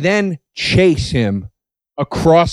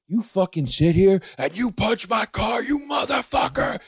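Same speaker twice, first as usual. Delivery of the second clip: high, held-back yell, softer than it sounds; mock enraged shout; 8 semitones higher, 5 dB quieter than usual. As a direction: imitate an outraged driver, voice rising high. Acts out the enraged driver.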